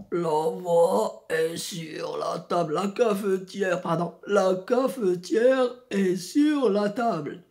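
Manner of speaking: low, slow, pushed from the back of the throat; slow deep chest voice, pressed from the throat. Shown as a demonstration. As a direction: drop into a deep throaty voice and drag it out. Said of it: "je peux utiliser la voix grave".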